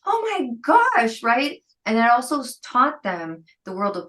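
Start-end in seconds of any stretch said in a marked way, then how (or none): 0.1-1.2 high-pitched retold thrill; mock amazement. Kids handed their trade show money, imitated high-pitched amazement. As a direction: imitate a kid's astonished delight.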